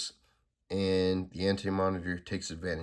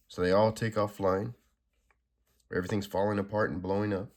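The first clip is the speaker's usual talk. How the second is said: ordinary delivery, nothing marked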